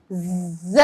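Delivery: ز buzzed quietly with teeth together; firm z buzz. Shown as a demonstration. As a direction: hold ز firmly behind the teeth. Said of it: "پھر ہم اس کو سختی کریں گے".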